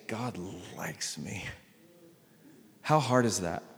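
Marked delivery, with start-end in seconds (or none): 0.1-1.4 slow, near-whispered affirmation; quiet. Shown as a demonstration. Pastor modelling a soft spoken confession for the congregation. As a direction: breathe out the words gently, much softer. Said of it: "take a deep breath and say".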